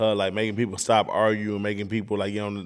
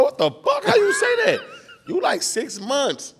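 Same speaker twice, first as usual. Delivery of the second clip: much higher, laughing disbelief; stunned disbelief; slower than usual. Retold or imitated. Dad recalling his baby's first word, high laughing disbelief. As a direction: squeak out the shock, laughing.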